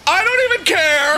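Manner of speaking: put-on high-pitched defiant kid; mock defiance. Friend playing the sulking son sent to his room, mock defiant. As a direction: imitate a kid insisting he isn't bothered. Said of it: "and you're like".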